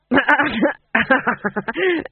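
loud, high tipsy cackle; drunken laugh. A narrator's reading. Fool laughing at his own remark, drunken cackle. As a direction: laugh helplessly, pitched high.

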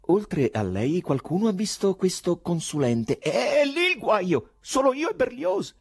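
high, loud agitated character voice; agitated poet. Acted. Poet arguing about the consultant, agitated. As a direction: exclaim it high and worked-up.

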